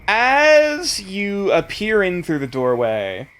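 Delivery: game master scene setting. Acted.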